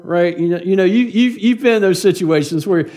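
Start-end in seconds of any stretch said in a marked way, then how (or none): none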